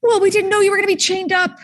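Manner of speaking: high-pitched defensive protest; flustered, high comeback in character. Acted. Defensive reply to captive's complaint.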